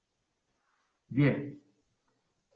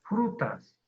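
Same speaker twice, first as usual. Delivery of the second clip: "Frutas" as FRÚ-tas, first syllable raised, ending falling; stress on FRU. Shown as a demonstration. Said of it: "No es". ("Frutas" con acento en FRU)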